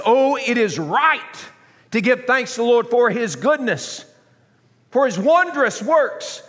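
high, loud praise from the pulpit; ringing sermon declaration; exultant preaching. Real, not acted. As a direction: proclaim thanks with fervor. Preaches at full force.

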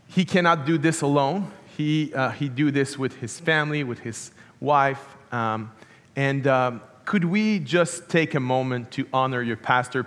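usual speaking voice

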